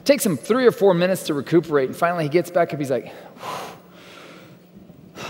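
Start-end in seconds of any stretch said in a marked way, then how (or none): 3.4-5.2 breathy exhale. Retold or imitated. Man recovers after the hit.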